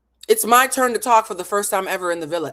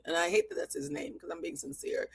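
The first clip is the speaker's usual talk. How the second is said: high aside; 12 semitones higher, 10 dB quieter than usual. Real, not acted.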